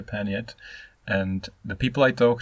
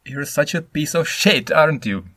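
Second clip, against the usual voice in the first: louder and higher, spitting out the insult; insulting outburst; 5 semitones higher, 6 dB louder than usual. Shown as a demonstration. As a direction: throw the insult at someone, loud. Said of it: "Or you could say".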